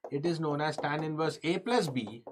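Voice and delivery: usual speaking voice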